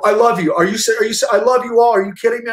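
high, excited, stammering disbelief; overjoyed outburst, retold with energy; freaking out. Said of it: "I'm sitting there freaking out".

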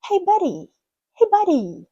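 high, loud "Hey, buddy" with d as a quick r; flapped d, excited. Shown as a demonstration. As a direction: call "Hey, buddy" brightly, "buddy" as "burry". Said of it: "звук р замість ді".

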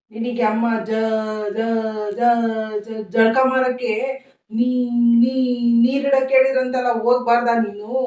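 soft repeated broken starts before the name; stammering son straining to call his wife; stuttering son. Acted. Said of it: "ನೀನ್ ನೋಡಿದ್ರೆ ತೊದ್ಲ".

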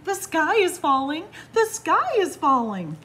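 high, loud storybook squawk; frantic alarm cry. A narrator's reading. Chicken Little won't listen to Squirrel, crying out in alarm.

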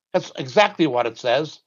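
ordinary talk

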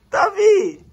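calling out. Real, not acted. Calling the child.

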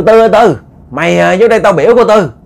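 odd possessed voice. A narrator's reading. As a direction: call out lightly in someone else's odd voice. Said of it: "cái giọng nói của Thiếm bây giờ nó lớ lớ lợ lợ". (giọng gọi hơi lớ lớ, như bị nhập)

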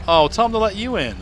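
high, loud parental call. Real, not acted. Calls to child in another room.

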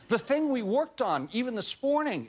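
high-pitched retold indignation; confronting the coach. Actor complaining to his dialect coach, high exasperated voice. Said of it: "And I said".

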